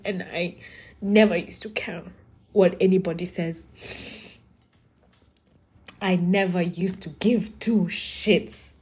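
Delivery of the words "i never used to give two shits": slow unvoiced whisper; hushed, slow confession. Real, not acted. Private admission, whispered slowly.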